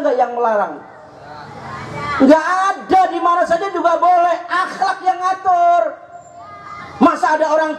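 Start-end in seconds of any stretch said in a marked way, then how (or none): 2.2-5.8 high, forceful preaching shout; emphatic exclamation. Real, not acted. Preacher answering his own question to the congregation, emphatic exclamation. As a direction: raise the voice to drive it home.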